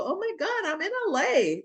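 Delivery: high-pitched giddy mimicry of excited young students. Oldest student mimicking giddy classmates thrilled to be in LA, giddy. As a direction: gush it in a very high, put-on squeal. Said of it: "who are so giddy".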